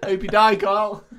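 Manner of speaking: heckler yell. Retold or imitated. Heckler again.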